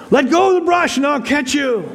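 very high, loud mock voice of God; voice from heaven. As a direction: repeat the call down from heaven, hammed-up. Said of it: "he hears a voice from heaven".

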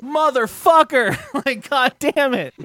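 high, slow, drawn-out curse; exasperated groan. Retold or imitated. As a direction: groan it in disbelief. Retold groan at extended stay.

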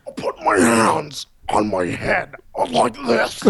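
hammed-up, laughing Edgar voice with a shouted burst; Vincent D'Onofrio impression. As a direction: play the bug man from the movie, cracking up. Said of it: "Was that a good Vincent D'Onofrio?".

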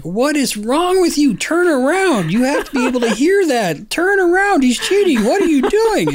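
high, loud mock yelling at the referee; fans yelling. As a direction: shout it up high like an angry fan in the crowd. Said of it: "people literally yell at me".

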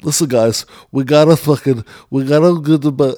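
mock congested actress; imitating stuffed nose. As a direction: impersonate her talking through a blocked nose. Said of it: "talking like her nose is stuffed".

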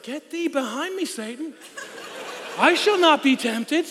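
hammed-up biblical defiance; mock King James rebuke. Teen refusing God's prompt, mock-solemn and high. Rebuking the Holy Spirit jokingly.

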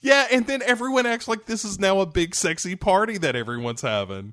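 usual speaking voice

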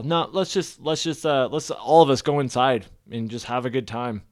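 ordinary talk, nothing marked

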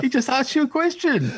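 giggling imitation of his reply; laughing mock quote. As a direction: laugh out the calm husband's line. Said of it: "just sitting there going".

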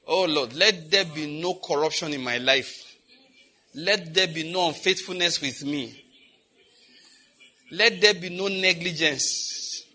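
slow, earnest plea to God; earnest prayer. Real, not acted. Pastor praying before the congregation, earnest prayer. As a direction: pray it out sincerely.

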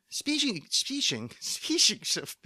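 "Speaking" slurred into "speeshing", pitched high; garbled, flustered repeats of "Speaking"; tongue-tied. Real, not acted. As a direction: stumble over "Speaking", mashing the k into sh. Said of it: "what is going on with your brain in your mouth".

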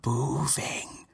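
eerie ghostly whisper; creepy whisper. Acted. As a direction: breathe it like a ghost.

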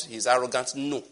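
normal talking voice

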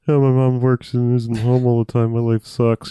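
mumbled mock complaint in a put-on voice; nineties movie teen. Ricky griping about mom always working, mock nineties-teen sulk. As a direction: grumble through it like a sulky kid. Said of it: "typical nineties movie 'cause Ricky's just like".

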